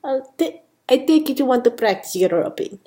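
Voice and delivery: quick, put-on Spanish accent; amateur Spanish accent. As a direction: chat in an attempted Spanish accent. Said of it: "Sophia's got a bit of a Spanish accent".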